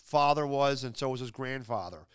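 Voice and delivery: normal talking voice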